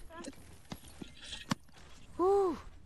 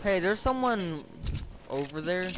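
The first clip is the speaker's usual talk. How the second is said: urgent calling; 6 semitones higher, 5 dB louder than usual. Real, not acted. Warning teammate during gunfire.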